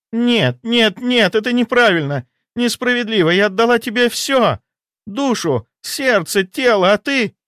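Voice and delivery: very high, anguished protest; hysterical mistress. Acted. Mistress who gave him everything, hysterical and very high-pitched. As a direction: protest as if falling apart.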